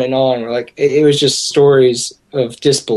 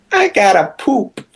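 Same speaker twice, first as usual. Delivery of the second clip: higher, thin-register old man impression; high-pitched voice; 4 semitones higher than usual. Old guy at the booth asking for a toilet, high-pitched. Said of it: "real high-pitched voice".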